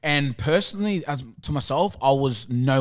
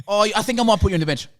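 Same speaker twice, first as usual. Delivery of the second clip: coach quoted, rushed; 8 semitones higher, 5 dB louder, much faster than usual. Retold or imitated. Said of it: "he then he goes".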